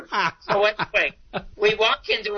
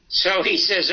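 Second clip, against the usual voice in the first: usual speaking voice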